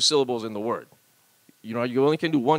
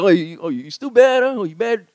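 slow, loud Hawaiian pidgin come-on; hammed-up pidgin flirting; pidgin pickup line; 4 semitones higher, 5 dB louder than usual. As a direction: draw out the pidgin question. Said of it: "I just wanna be like".

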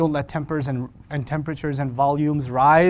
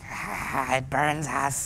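drawn-out, tormented creature voice; Lord of the Rings creature; 5 dB quieter, much slower than usual. Acted. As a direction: wail it like a suffering creature. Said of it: "You know, the Lord of the Rings".

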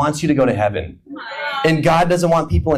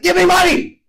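sudden high shrill demand, much higher; demanding yell; 13 semitones higher, 3 dB louder than usual. Retold or imitated. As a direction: bark it out like a greedy roommate. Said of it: "he's like".

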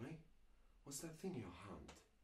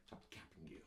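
whispering; 5 semitones lower, 9 dB quieter than usual. Acted. Whispered threat before shooting.

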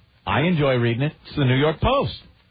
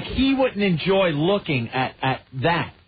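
normal talking voice

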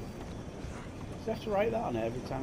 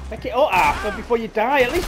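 loud, higher yell at the screen; 8 semitones higher, 12 dB louder than usual. Real, not acted. Gamer yelling at his character to grab the hat, loud yell. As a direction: bark it at the character like he's deaf.

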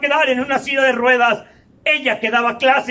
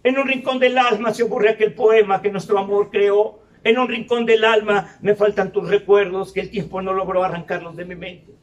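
lower, lyrics spoken like a poem; reciting song lyrics; 6 semitones lower than usual. Retold or imitated. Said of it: "la puta canción de [name]".

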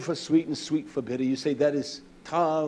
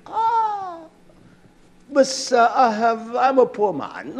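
mock humble; 9 semitones higher, 6 dB louder, slower than usual. Preacher mocks himself humbly.

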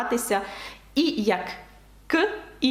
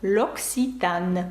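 slow French model; 4 semitones lower, much slower than usual. Shown as a demonstration. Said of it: "Ця марка читається як".

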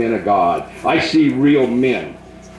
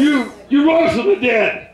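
high, shouted renunciation, much higher; shouted prayer; 12 semitones higher than usual. Real, not acted. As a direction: cast it out at the top of the voice.

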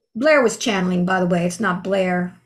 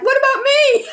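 high, laughing "what about me"; laughing plea; 5 dB louder than usual. Retold or imitated. Retelling her plea to her higher self, laughing high. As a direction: plead through laughter. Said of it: "And I kept saying".

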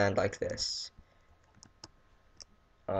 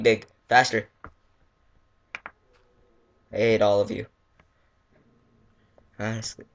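ordinary delivery

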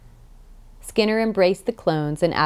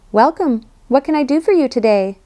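high, louder, bright machine greeting; chirpy device voice; 8 semitones higher, 4 dB louder than usual. Acted. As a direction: chirp it like a cheerful gadget. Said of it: "the transmitter chirped".

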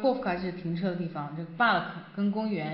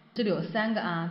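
ordinary talk

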